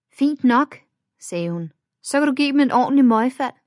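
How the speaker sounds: brisk friend. A narrator's reading. Friend urges fighting back.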